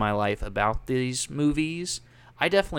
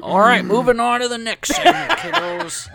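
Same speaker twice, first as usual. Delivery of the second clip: put-on grumpy old man; 12 semitones higher, 7 dB louder than usual. Keeps the old man bit going.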